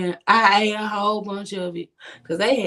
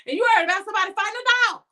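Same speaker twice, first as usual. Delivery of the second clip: higher, very fast indignant rant; 11 semitones higher, much faster than usual. Retold or imitated. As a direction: fire the words at her in outrage. Ranting at friend about Vick.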